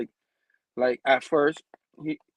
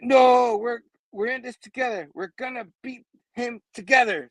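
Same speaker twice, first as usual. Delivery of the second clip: high, insistent rallying cry; acted pleading insistence; urgent protest; 7 semitones higher than usual.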